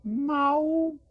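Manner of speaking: high drawn meow; high cat meow. Shown as a demonstration. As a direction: mew like a kitten. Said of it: "Can everybody say that".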